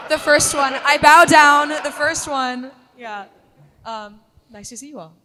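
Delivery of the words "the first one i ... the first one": mock reverence. Real, not acted. Bowing to the original ranger.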